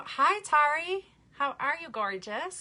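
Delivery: high, slow sing-song hello; excited greeting. Real, not acted. Greeting a viewer by name, excited sing-song.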